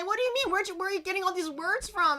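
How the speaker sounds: very high, loud and fast disbelief; shocked parents. Retold or imitated. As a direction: exclaim in total astonishment. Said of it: "they're just like".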